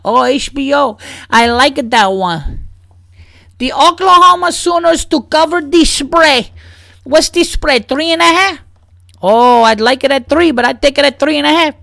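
put-on high Spanish-accented customer. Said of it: "the Spanish guy was like".

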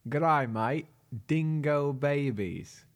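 bad Australian accent. One shot on request at an Australian phrase, bad Australian accent. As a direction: do [name]'s hammed-up attempt at an Australian accent. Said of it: "in an Australian accent".

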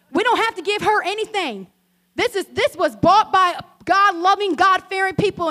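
self-righteous sass building; mock indignation. Pastor hams up her self-righteous thought about the rude lady, "don't" stressed. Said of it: "I feel the attitude coming on".

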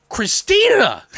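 high, loud mock-exasperated name call; exasperated cry. Teller scolding partner for not recording, exasperated cry. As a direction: wail the name in disbelief.

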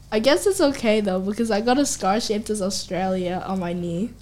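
normal talking voice

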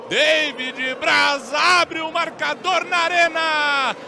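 shouted excited goal recap; explosive goal commentary. Real, not acted. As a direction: keep yelling the replay.

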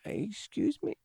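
high, soft, tentative try at a Chinese accent; half-hearted Chinese accent; attempted Chinese accent. Shown as a demonstration. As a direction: feel your way timidly into a Chinese accent. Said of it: "do your Chinese accent?".

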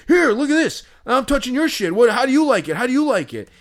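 very high, loud mocking taunt. Cleats guy taunting prankster with his binder, high mocking taunt. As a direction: wave it in his face and taunt. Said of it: "he's like".

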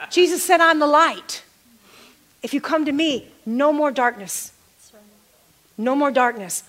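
rising and falling preacher cadence; preaching. Acted. Pastor preaching to his congregation about Jesus, fervent sermon delivery. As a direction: preach it to the room with fervor.

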